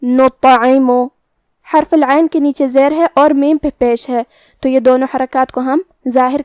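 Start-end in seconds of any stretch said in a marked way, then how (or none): 0.0-0.9 نطعم chanted with a full, echoing T; heavy bouncing taa. Shown as a demonstration. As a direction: round the mouth and bounce the T in نطعم. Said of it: "ہلا کے پڑھیں گے". (نطعم میں طا بھاری اور ہلا کر)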